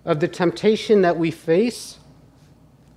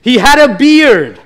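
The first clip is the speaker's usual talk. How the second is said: much higher, louder angry outburst; angry father-in-law; 9 semitones higher, 10 dB louder than usual. Retold or imitated. Father-in-law snaps at the praying son-in-law, loud angry outburst. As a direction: bark it out like an offended old man. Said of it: "his father-in-law gets mad and he goes".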